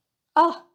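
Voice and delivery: a loud, brief falling "啊" of realizing; sudden understanding. Shown as a demonstration. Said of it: "suddenly understand something".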